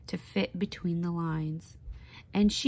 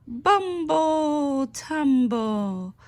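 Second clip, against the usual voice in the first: slow, higher and louder, drawn out for effect; dramatic exclaim; 7 semitones higher, 6 dB louder, much slower than usual. A narrator's reading. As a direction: stretch each word like a tumble.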